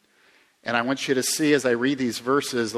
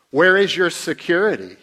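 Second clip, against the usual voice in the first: preaching loud; 6 semitones higher, 9 dB louder than usual. Real, not acted. Preaching rhetorical questions at full force.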